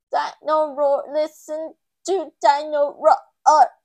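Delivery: loud, slow mechanical toy voice; talking toy as the batteries die, loud and slow; toy dinosaur. A narrator's reading. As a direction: run down like a toy losing battery. Toy's voice fading out.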